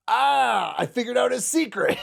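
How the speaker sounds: high, mock-triumphant gotcha; gleeful discovery. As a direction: crow it like a silly hero who cracked the case. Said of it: "Aragorn's like".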